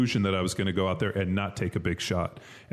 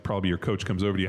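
normal talking voice

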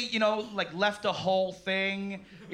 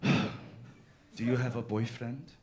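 timid mom; 7 semitones lower, 5 dB quieter, slower than usual. Retold or imitated. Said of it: "she was like".

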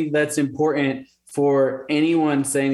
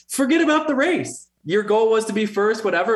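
lightly voiced wife, much higher; a brisk, higher take on his wife; imitating wife; 7 semitones higher than usual. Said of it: "she's like".

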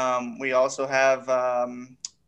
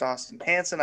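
ordinary talk

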